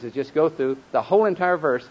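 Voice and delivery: ordinary talk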